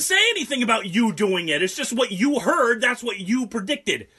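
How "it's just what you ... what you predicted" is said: high, slow, exasperated insistence; louder, higher, defensive; irritated arguing. Real, not acted. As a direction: spell it out to a co-host, annoyed. Real squabble with co-host over accent.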